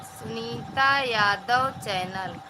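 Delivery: drawn out so viewers catch the name; slow deliberate repeat of Sunita Yadav channel; slow, spelled-out repeat. Real, not acted. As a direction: say the channel name slowly, word by word. Repeats the channel name slowly.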